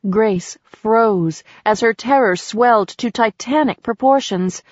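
usual speaking voice

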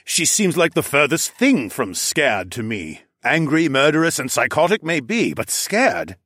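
put-on accented, disbelieving scoff. A narrator's reading. Warrior disputes that the prisoner is scared, scoffing. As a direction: scoff at the very idea. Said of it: "Zoclan scoffed".

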